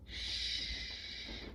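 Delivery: soft unvoiced shush. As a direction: hush it like a sleeping house. Hushing the house.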